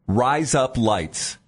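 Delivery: slow, each word set apart; deliberate demo. Shown as a demonstration. Cohost feeding her a phrase to repeat, slow and deliberate. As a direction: say it slowly for someone to repeat. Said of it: "repeat after me".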